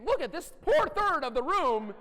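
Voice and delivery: high, loud, slow mock sympathy; mock pity. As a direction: pity the poor third of the room, hammed up.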